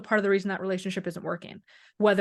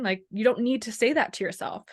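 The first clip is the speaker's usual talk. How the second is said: normal talking voice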